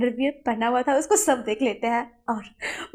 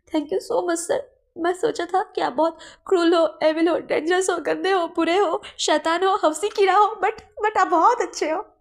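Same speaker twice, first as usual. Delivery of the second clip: gushing servant; 6 semitones higher, 4 dB louder than usual. Acted. Servant thanking his boss, high and gushing. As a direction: gush with naive excitement.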